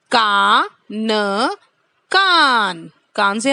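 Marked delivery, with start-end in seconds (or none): 0.1-1.6 कान stretched very slowly; slow drill. Shown as a demonstration. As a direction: drag out कान. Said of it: "उच्चारण करना है इसका बार-बार".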